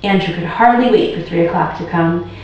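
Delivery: plain reading voice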